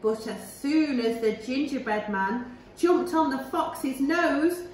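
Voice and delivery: plain reading voice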